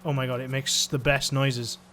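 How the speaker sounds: normal talking voice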